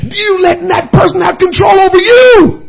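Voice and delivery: preacher mourning Ahithophel, crying shout; preaching cry. Real, not acted. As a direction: wail the tragedy out.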